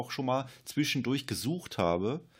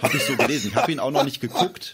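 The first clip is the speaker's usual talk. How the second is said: laughing; 4 semitones higher, 9 dB louder, faster than usual. Real, not acted. Laughing at own summary.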